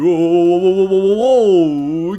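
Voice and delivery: loud, stretched oohs of thrill; excited oohs. Real, not acted. Commentator cheering the car's pool jump with loud oohs.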